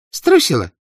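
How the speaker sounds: high squeaky echo; squeaky girl. Acted. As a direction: squeak it out loud. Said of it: "пискнула Вава".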